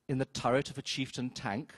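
usual speaking voice

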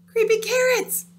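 high, loud panicked cry; terrified shout. Acted. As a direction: shout it in fright. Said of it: "he shouted".

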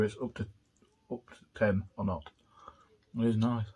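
chewing while talking; talking between bites of fries; mouth full. Real, not acted. Seen 3: fingers feed fries into mouth, keeps picking more while talking.

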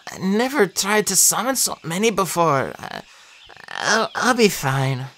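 faltering, weakened voice; halting, strained reply; weak, injured. A narrator's reading. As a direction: struggle to get the words out after collapsing. Nico speaks barely revived.

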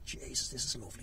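soft whispered sigh of comfort; whispered pleasure under a duvet with soup; contented whisper. Retold or imitated. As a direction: breathe it out, cosy and content. Cosy on couch with soup.

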